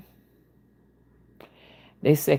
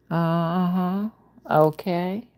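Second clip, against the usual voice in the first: normal talking voice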